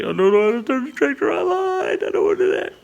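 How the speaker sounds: fast, squeaky swings up then down; imagine blurting the truth in a panic; mock panicked confession. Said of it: "I don't wanna go down there and say".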